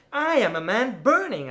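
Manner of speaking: high, loud cry; screamed. Acted. Poet screaming to the tempter that he burns, loud cry. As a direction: scream out the burning. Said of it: "I screamed".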